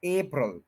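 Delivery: अप्रैल as AY-prul, opening on the letter A; long "ay", then a short "prəl" in अप्रैल; English pronunciation. Shown as a demonstration. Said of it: "पहले ABC वाला जो ए है". (अप्रैल को "ए-प्रल" बोलें)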